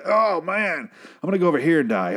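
hammed-up high wail of a dying extra; overacted dismay, high and comic; mock dying groan. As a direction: whine your way theatrically toward death. Acts out another dying extra.

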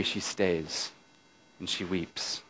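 barely above a breath, mournful; hushed. A narrator's reading. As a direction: let it fall away almost silent. She stays and weeps, gently retold.

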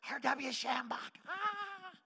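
high, drawn-out fangirl squeal; excited young fangirl. Retold or imitated. Preacher mimics himself thrilled to meet his idol, fangirl excitement. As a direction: gush the name slowly, ending in a high "ah". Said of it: "excited like a ten-year-old girl".

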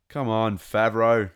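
loud playful scold; mock scolding. Real, not acted. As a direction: chide him, half-joking. Scolding the showrunner.

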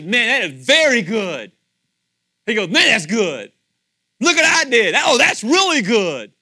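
loud, high, overjoyed cry of pride; delighted God. Acted. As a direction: shout it like a proud maker admiring his work. God delighting over creating you.

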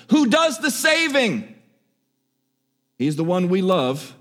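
ordinary talk

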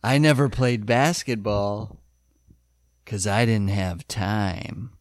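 low, slow, deep-voiced big guy; Bull impression. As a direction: drop low and drag it out like Bull. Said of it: "Please deliver it like Bull did".

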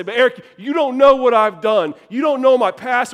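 high, forceful imitation of a doubter; acted protest. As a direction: push back loudly at the preacher. Acting out an objector's protest.

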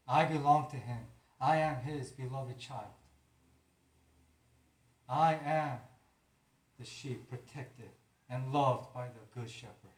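loud, firm declaration against the enemy; bold declaration. Pretend. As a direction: proclaim it with authority. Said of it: "You can tell the enemy".

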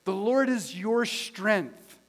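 slow, emphatic, rising in force; forceful declaration. Real, not acted. Pastor repeating it to the congregation, slow and forceful. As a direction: lift the voice, landing each word. Same line, restrained then forceful.

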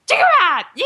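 high squeaky Jawa chatter. Retold or imitated. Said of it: "the Jawas where they're like".

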